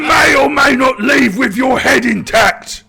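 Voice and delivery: booming, high-pitched warlord shout; orc warlord. Acted. Warlord taunting the captured player, shouting. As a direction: bellow the threat like a mad orc chief.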